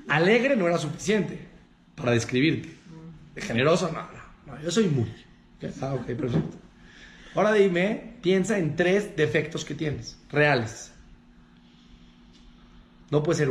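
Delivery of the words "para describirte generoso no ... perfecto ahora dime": ordinary talk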